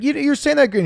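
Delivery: high, loud imitation of an irritated mother; imitating mom shooting back at her son, high and loud; sarcastic mom. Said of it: "and she'd be like".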